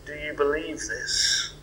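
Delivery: usual speaking voice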